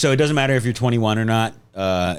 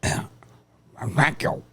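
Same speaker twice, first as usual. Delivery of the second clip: attempted squawky duck voice; Donald Duck accent; 5 semitones higher, 4 dB quieter than usual. Podcaster strains to answer as a cartoon duck, shaky duck impression. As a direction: talk through a garbled duck voice. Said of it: "doing a Donald accent".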